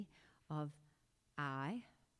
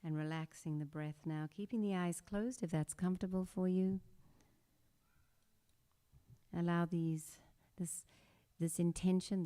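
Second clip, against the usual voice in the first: guided meditation. Acted. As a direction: guide the class through breath and intention. Leads a breathing meditation.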